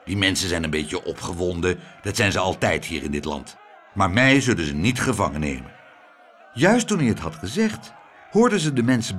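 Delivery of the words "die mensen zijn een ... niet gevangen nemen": low, unworried grumble; grumbling. A narrator's reading. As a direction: lower voice, dismissing all danger. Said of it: "bromde meneer Tump".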